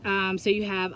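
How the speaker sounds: usual speaking voice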